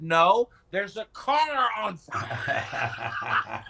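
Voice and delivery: loud, slow, insistent mock yell; Glenn Beck yelling. Retelling Glenn Beck on air, a slow loud mock yell. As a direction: hammer it out slowly like a fed-up host. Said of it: "And he said".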